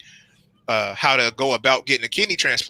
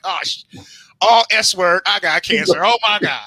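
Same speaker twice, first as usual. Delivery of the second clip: mock panic; 5 semitones higher, 4 dB louder than usual. Panicked self-quote about cancer.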